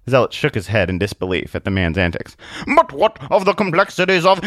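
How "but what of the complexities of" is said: dramatic challenge about to be cut off; protesting objection. Acted. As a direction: object with grand earnestness.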